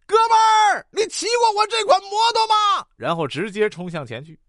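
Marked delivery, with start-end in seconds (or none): 0.1-2.9 high, full shout to a passing driver; loud calling out. Acted. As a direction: yell it across traffic. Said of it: "大声地喊".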